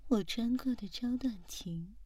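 ordinary talk

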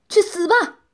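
loud, high, frenzied shout; crazed roar. A narrator's reading. Gunman aiming at the woman who controls him, crazed roar. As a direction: roar it wildly as a death threat. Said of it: "疯狂地吼道".

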